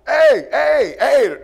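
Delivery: high, loud mock shout of an officer; officer calling out. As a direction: holler "hey" like a guard stopping runners. Prison officer calling out to runners.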